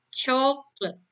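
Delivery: Chocolate said CHOK-let, stress on the first syllable; English Chocolate, CHOK-let; two-syllable English. Shown as a demonstration. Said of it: "dia hanya punya dua silabel".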